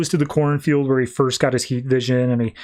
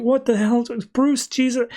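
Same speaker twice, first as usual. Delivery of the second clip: shocked outburst; 8 semitones higher than usual. Retold or imitated. Hit by Wayne kryptonite satellite.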